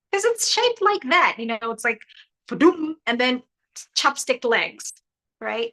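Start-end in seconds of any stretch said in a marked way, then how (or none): 2.5-3.0 mock boom effect. Sound effect for the shape.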